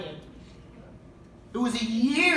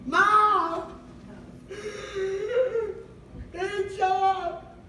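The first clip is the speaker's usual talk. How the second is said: high, slow, hammed-up wail with a cry; wailing to mom; 13 semitones higher, much slower than usual. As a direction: drag out show up like a mock sob. Calling mom crying about kids.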